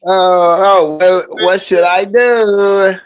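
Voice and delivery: high, slow put-on wail; mock helplessness. Playing the helpless soap dropper for laughs, high drawn-out voice. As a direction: draw it out in hammed-up helpless dismay.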